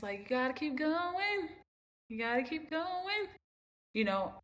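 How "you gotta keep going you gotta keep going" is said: mom's encouraging voice. Retold or imitated. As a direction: gently urge her to keep going. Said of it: "How many times I hear that voice".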